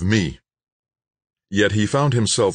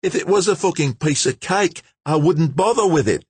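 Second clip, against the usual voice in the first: high, heated Aussie retort, louder by the end; temper rising; 13 semitones higher, 3 dB louder than usual. A narrator's reading. George arguing with Nobo to join the rescue, temper rising. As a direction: fire back as your temper climbs. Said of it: "his temper rising".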